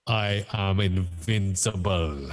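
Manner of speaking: slow, drawn-out attempt at a Russian villain; Russian-accented boast, word by word; stately mock-Russian villain voice. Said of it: "I can't do a Russian accent".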